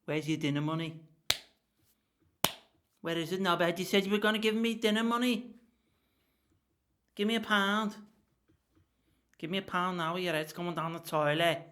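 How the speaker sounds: a hint of a menacing school bully; lightly voiced bully demanding money; bully shaking down a kid for dinner money, mock menacing. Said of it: "as if I'm a, I'm a bully in school".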